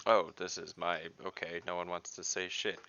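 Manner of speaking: ordinary delivery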